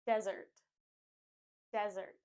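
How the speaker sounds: Desert as "DEZ-urt"; strong DEZ, weak urt in Desert; stress on first syllable. Shown as a demonstration. Teacher modelling desert for learners, stressed DEZ. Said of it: "put the stress on the first syllable".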